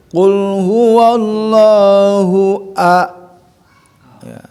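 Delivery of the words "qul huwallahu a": slow chanted "qul huwallahu a"; slow recitation. Shown as a demonstration. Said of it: "nah baru situ haknya".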